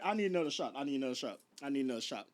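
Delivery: low, quiet, weary repetition; subdued plea to nobody in particular; weary aside. Real, not acted. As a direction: drop it down low and soft, worn out. Tired aside asking for a drink.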